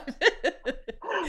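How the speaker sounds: real high-pitched laugh; amused giggle up high; laughter. Real, not acted. Amused by homecoming remark.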